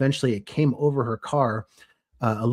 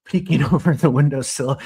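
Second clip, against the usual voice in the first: ordinary delivery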